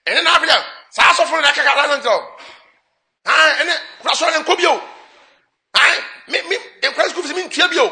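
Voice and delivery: hammed-up outraged man listing grievances; mock angry husband. Husband complaining to his wife about the pastor, high loud mock outrage. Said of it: "Bɛrima sɛ".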